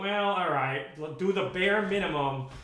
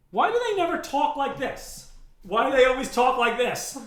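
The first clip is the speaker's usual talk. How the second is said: loud, higher, frustrated outburst; 7 semitones higher, 8 dB louder than usual. Real, not acted. Ranting at bad questioners, loud and frustrated. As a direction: vent it, baffled and annoyed.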